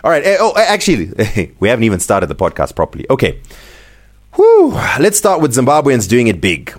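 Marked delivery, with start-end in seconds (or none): 4.3-4.7 high, loud exhaled whew. Real, not acted. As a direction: let out a big high "whew". Resetting before starting the podcast.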